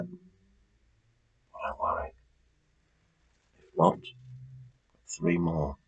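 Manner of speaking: slow breathy whisper, barely audible; whispered aside. Real, not acted. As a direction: drop to a hush for a quick reassurance. Quiet aside to players.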